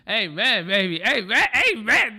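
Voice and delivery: mock preacher. Streamer hams up church call and response, mock preacher shouts. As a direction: shout amen like a revved-up preacher.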